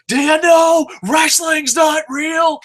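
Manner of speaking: high, loud, slow sneering taunt; mocking. Kid mocking wrestling fans, loud high sneer. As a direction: jeer it out, drawn and shrill with scorn. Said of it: "I'd mock people".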